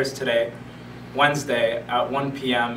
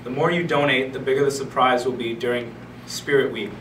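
normal talking voice

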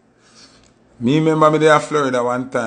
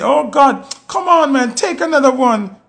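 mock peer pressure; 10 semitones higher than usual. Friends pressuring to drink more.

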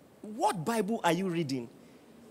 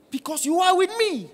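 higher, louder, ringing out; fervent preaching cry; 12 semitones higher, 8 dB louder than usual. Real, not acted. Preacher crying out to his congregation, loud and fervent. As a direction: cry the promise out to the church.

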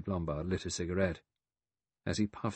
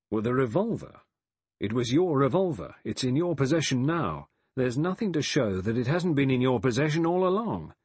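louder, higher-pitched accusation; accusing; 5 semitones higher, 5 dB louder than usual. A narrator's reading. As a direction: press the charge home forcefully. Accusing Lombard over the revolver.

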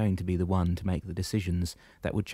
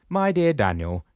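higher, louder commanding shepherd voice; booming old shepherd, pitched up; 7 semitones higher, 6 dB louder, slower than usual. Acted. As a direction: address him firmly from above. Damianos speaks, voiced figure.

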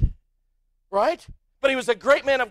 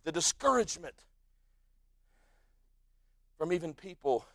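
lower, much softer preaching; hushed preaching; 9 semitones lower, 6 dB quieter than usual. Real, not acted. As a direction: draw them in, quiet and low. Hushed low preaching stretch.

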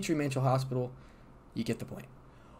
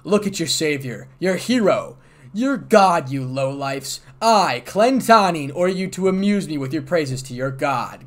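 louder, higher, lordly and full of pride; arrogant dragon lord; 3 semitones higher, 8 dB louder than usual. A narrator's reading. Dragon lord Clan demanding praise from the gathered dragons, arrogant and loud. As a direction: command the crowd like a conceited god. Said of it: "staring at the dragon with arrogance and pride".

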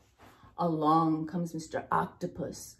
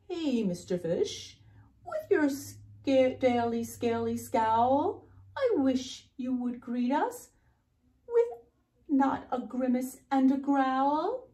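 higher storybook jellyfish, rising phrase ends; a hint of reproachful jellyfish; lightly voiced scolding jellyfish; 5 semitones higher, 5 dB louder than usual. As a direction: appeal to a grumpy friend in a lifted voice.

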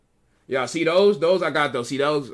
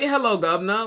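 put-on British accent; 6 semitones higher, 3 dB louder than usual. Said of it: "talk in a British accent".

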